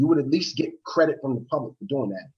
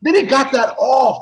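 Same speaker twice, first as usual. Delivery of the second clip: high, loud and slow, stunned; louder and higher, dragged out in disbelief; excited disbelief; 12 semitones higher, 8 dB louder, slower than usual. Real, not acted. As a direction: relive the shock out loud. Excited retelling of knockout.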